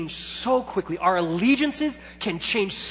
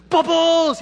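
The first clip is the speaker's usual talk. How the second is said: mock excited instructor; 11 semitones higher, 8 dB louder than usual. Mimicking the energetic instructor.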